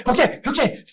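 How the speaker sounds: high, hurried placating voice; panicked yielding. Acted. To the man who shouted, panicked yielding. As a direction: give in fast, high-pitched and frightened.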